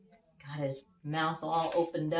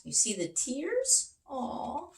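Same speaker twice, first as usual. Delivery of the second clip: sympathetic aw; 10 semitones higher, slower than usual. Acted. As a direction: feel sorry for the poor elephant. Pitying the sad elephant.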